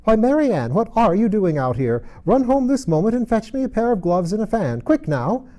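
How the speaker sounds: high, loud angry orders; angry call. A narrator's reading. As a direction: order the maid about crossly. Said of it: "called out to her in an angry tone".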